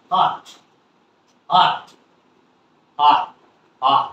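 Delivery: "হট" with a short open "ah" vowel; short "ah" sound. Shown as a demonstration. Teacher drills the word for learners, "হট" with short "ah". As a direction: drill "হট" as one short syllable with "ah", pausing between repeats. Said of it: "আ উচ্চারণ হচ্ছে হট".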